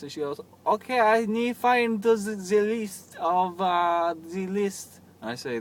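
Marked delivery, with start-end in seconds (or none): 0.6-5.0 high, loud mock German accent, halting; imitated German accent. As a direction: struggle for words in a hammed-up German accent. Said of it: "stereotypical German accent".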